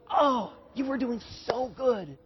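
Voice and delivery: soft, slow sympathetic praise; encouraging father. Acted. Father to toddler after first steps, softly encouraging. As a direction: gently console a child who fell. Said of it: "it's more like a father who's seeing his kids".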